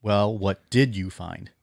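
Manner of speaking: "did" leaned on, whole line slow; stress on did. Retold or imitated. Re-reading the quote to show the emphasis, stress on "did". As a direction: slowly, punch "did". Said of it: "with an emphasis on the word did".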